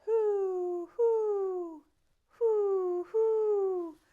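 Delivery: loud high hooting; owl hoot. Acted. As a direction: hoot steadily like an owl at night. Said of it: "called Owl".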